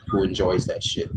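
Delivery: ordinary talk, nothing marked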